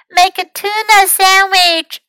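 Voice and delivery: high, loud little-one voice; small child's voice. A narrator's reading. Hurt Louis orders Fox about, high small voice. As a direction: make demands in a high small voice.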